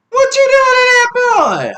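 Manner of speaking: high, loud outraged neighbor voice; the downstairs lady yelling in alarm; nosy neighbor. Retold or imitated. Neighbor in two B scolding the parent, high and loud.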